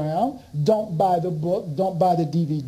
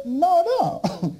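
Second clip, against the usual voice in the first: slow, high, laughing reply; laughing retort; 11 semitones higher, slower than usual. Retold or imitated. He tells the director he doesn't understand, laughing. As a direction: hold it back through a laugh.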